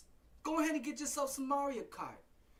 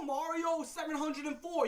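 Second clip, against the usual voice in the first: ordinary talk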